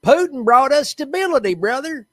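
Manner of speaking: high, hammed-up Southern-Russian mash; playful high Russian-tinged Southern accent; bad Southern-Russian accent. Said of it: "I love bad accents".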